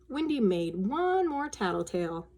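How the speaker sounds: plain reading voice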